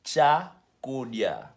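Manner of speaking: Chakudya broken into a long cha, then kudya; Chakudya, stretched out for learners; syllable-by-syllable pronunciation. Shown as a demonstration. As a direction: sound out Chakudya very slowly. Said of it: "That's how you pronounce it".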